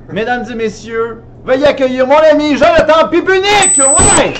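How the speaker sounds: loud, high showman guest intro; grand entrance announcement. Acted. (annonce grandiose de l'invité)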